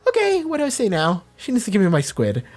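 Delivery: nervous high-pitched mock panic; awkward panic. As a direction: squirm through it, voice jumping up. Imagined awkward customer's inner thoughts.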